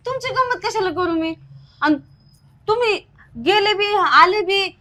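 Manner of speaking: loud, heated accusation; angry shouting. Acted. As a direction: shout it at him, fed up. Wife angrily accusing husband.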